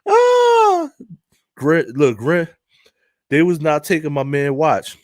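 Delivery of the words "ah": a loud cry of laughter, much higher; high laughing shriek. Real, not acted. Laughing at his own impression, loud high shriek.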